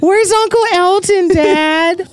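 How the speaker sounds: very high, hammed-up child voice; squeaky mock child asking Dad; imitated little kid. Playing a kid questioning dad.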